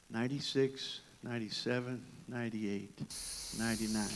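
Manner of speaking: a quiet, measured count of sheep; a shepherd checking his flock one by one; shepherd counting softly. Retold or imitated. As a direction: count each stone off under the breath. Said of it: "So he's counting it out".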